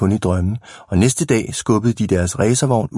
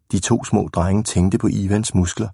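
plain reading voice

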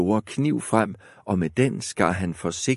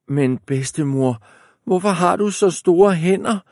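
put-on worried high girl; girl voice, higher and louder; 10 semitones higher, 5 dB louder than usual. A narrator's reading. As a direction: ask more anxiously.

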